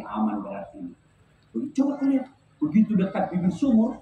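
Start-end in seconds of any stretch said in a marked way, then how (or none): 0.1-0.9 low, soft relieved thought; quiet aside of relief; inner voice. Acted. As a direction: think it to yourself, much lower. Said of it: "Kata suami dalam hati".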